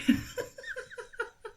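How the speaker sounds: soft unvoiced giggle, high; a quick airy giggle; breathy laugh. Real, not acted. Vlogger laughing at the offer of buns, airy giggle.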